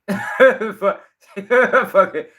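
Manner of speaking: breaking into a laugh on the curse. Real, not acted. Laughing off his own slip while ranting to viewers.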